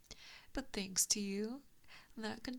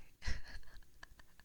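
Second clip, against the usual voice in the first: eerie whispered laugh. Acted. Gloating over the trap.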